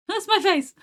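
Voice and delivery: high, loud yelp of shock; shocked shout. Real, not acted. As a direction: blurt it out in loud disbelief. Reacts to a drawn face.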